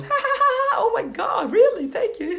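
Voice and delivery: mock squealing laughter, very high; hammed-up giggly falsetto gushing; girl's delighted reaction. Girl reacts to the opener, hammed-up high delighted laughing. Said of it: "And then he goes".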